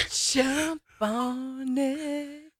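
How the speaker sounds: sexy voice. Acted. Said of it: "Why do you sound like me during my T C L commercial".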